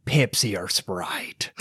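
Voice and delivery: put-on drawn-out fair-food accent; Renaissance fair accent. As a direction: ask it in a bad old-time accent. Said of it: "I'm terrible at accents, but, like, people".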